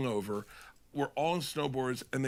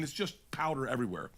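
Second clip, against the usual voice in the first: usual speaking voice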